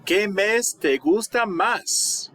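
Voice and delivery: high and slow, asked clearly; slow question. Shown as a demonstration. Teacher asking students their favorite month, slow and high. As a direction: ask it slowly to the class. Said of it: "teacher posing question".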